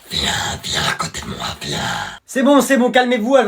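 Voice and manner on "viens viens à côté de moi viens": much lower, put-on creepy voice; creepy low beckoning. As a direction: lure her over in a sinister voice. Creepy boy beckons newcomer.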